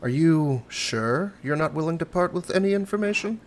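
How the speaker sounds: haughty stranger pressing for info, lightly put on; character voice.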